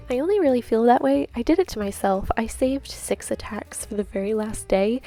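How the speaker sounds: usual speaking voice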